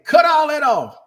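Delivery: hammed-up crowd yelling to cut it off; mock demand. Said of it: "So if y'all say".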